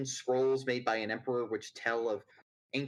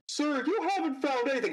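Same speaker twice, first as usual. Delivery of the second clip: timid assistant voice; 12 semitones higher, 3 dB louder than usual. Acted. Assistant Patrick speaks.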